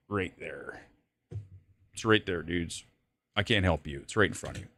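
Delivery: dropped low and soft, teasing the chat; mock hushed. Streamer teasing viewers who can't spot it, low and soft. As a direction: murmur it down low, half-joking.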